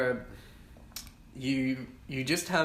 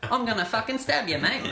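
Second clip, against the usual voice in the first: put-on higher, jokey Australian-accent threat; hammed-up Aussie tough guy; mock Australian accent; 8 semitones higher than usual. Friend keeps teasing with a mock Australian accent. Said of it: "Your Australian accent is worse".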